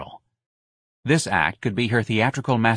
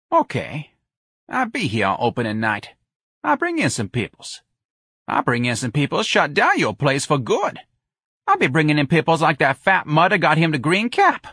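scheming Jones character; 7 semitones higher than usual. A narrator's reading. Jones threatening Lana with opening-night guests, defiant. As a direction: give in, then build a swaggering threat.

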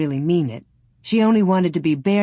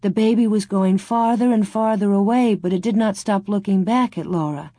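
ordinary delivery, nothing marked

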